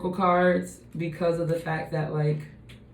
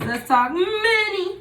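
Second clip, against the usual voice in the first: playful high-pitched announcement; 12 semitones higher, 4 dB louder than usual. Real, not acted. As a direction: announce it in a high sing-song. Playful announcement of next segment.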